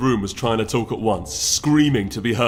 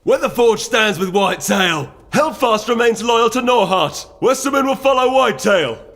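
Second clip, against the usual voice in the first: much higher, loud rallying cries; shouting delegates; 13 semitones higher, 8 dB louder than usual. Acted. Delegates screaming their loyalties across the council chamber, shouting. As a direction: yell each loyalty over the din. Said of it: "screaming at the others".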